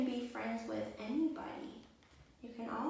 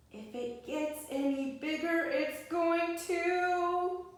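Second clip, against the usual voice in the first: suspenseful; 8 semitones higher, 7 dB louder, slower than usual. Acted. Balloon about to burst.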